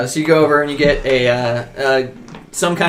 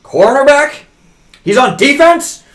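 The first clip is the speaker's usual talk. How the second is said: higher, louder furious mayor; outraged yell; 10 semitones higher, 8 dB louder than usual. Acted. Mayor dad yelling at the coach on the phone, furious. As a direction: blow up at the coach like a spoiled official.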